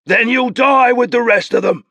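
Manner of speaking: high, loud threatening bellow; furious bellow across the river. Acted. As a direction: roar the threat after them. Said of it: "Nabor bellowed".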